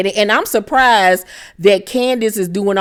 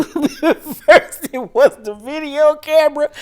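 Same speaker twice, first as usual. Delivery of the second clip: laughing; 7 semitones higher, slower than usual. Real, not acted. Laughing while recapping the show.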